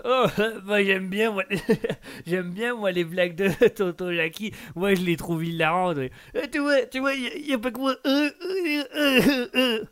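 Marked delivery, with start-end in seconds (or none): 6.3-9.8 high pitched whimpering laugh; uncle's whiny laugh. Acted. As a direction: whine and giggle like the uncle. Uncle whining laugh again. (tonton qui ricane, voix très aiguë)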